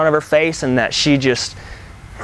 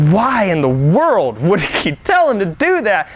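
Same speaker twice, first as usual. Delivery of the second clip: high, drawn-out disbelieving question; utterly baffled, pitched way up; incredulous; 7 semitones higher, slower than usual. Retold or imitated. Preacher voicing his own baffled reaction.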